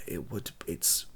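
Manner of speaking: ordinary delivery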